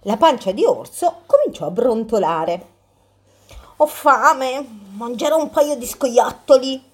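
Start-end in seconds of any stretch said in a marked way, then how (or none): 3.8-6.8 lightly voiced hungry bear, higher; a greedy bear, announcing his plan. Bear voice in the story.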